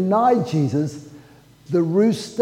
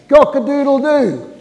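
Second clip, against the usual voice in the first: ordinary delivery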